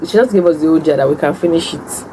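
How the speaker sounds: usual speaking voice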